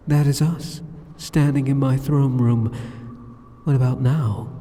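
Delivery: ordinary talk, nothing marked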